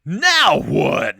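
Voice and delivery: loud, high hammed-up shout; exasperated yell. Narrator voicing the sinking boater, exasperated yell. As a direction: blurt it out at full volume in mock panic.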